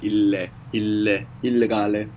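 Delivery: il-leh, il-leh, "illegale" with a lengthened L; both L's pronounced. Shown as a demonstration. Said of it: "you pronounce both L's as well".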